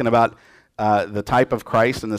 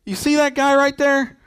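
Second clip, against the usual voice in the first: much higher, sneering accuser's voice; resentful tattletale; 9 semitones higher than usual. Retold or imitated. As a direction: point out the guilty guy mockingly, high up. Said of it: "Joseph doesn't say".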